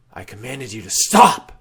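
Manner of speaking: loud, imperious order; a wraith reasserts his command; commanding cry. Acted. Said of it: "the voice cries".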